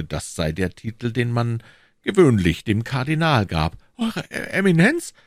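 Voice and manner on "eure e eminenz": plain reading voice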